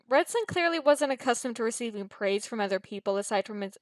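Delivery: plain reading voice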